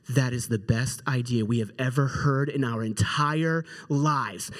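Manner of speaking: low-pitched mock awe; lightly acted reverent amazement, low in pitch; awestruck kids. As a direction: kids solemnly declaring a genius idea. Brothers marvel at his idea.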